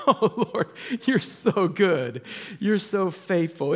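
slow, drawn-out grateful prayer; acted awestruck thanks; overcome praise. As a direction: marvel slowly to God, pitch lifted. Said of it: "I just sat there just going".

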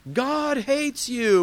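high, loud, drawn-out chant; mock hateful preaching. Protester with a sign condemns passers-by, mock hateful shout. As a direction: stretch it out like a picket-line heckler.

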